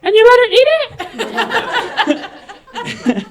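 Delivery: very high, loud mock screech; appalled outburst. Coworker hearing about the sticker, imitated appalled screech. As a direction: shriek it in comic outrage.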